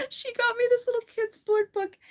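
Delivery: high-pitched, giggly delight; laughing. Real, not acted. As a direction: talk through giggles, voice way up. Laughing while showing a cute gift.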